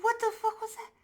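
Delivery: high-pitched startled shout; squealing what-the; shocked. Retold or imitated. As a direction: yelp in shock at the tooth. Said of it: "I was like".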